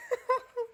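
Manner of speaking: a short high giggle. Real, not acted. Vlogger laughing at the table's faces, high giggle. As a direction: burst into a giggle at the scene.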